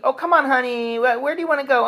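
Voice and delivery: high, wheedling coax; mock pleading. Asking his woman where to eat, in a high mock-pleading voice. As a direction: lightly plead with her. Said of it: "It doesn't mean".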